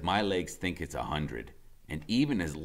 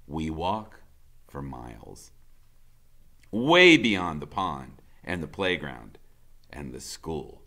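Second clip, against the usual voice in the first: usual speaking voice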